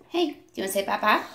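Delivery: usual speaking voice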